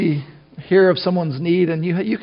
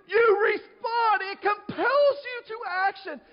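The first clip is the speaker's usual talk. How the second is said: very high, strained voice, drawn out; urgent cry; 14 semitones higher, 4 dB quieter, slower than usual. Acted. Preacher showing how a burn forces a reaction, high urgent cry.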